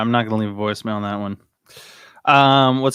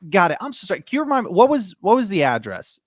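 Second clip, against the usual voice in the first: apologetic caller; 8 semitones higher, 6 dB louder than usual. Shown as a demonstration. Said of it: "fumbling through it".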